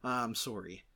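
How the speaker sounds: imitated Canadian "sore-ee"; Michael J. Fox's Canadian accent. Dad after Teen Wolf night, imitating a Canadian accent. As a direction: mimic a strong Canadian accent on "sorry". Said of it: "so I've been saying".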